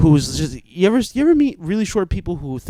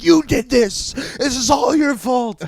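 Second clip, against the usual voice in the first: retold angry yell; 9 semitones higher, slower than usual. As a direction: yell the blame like a furious bar owner. Owner blames the narrator.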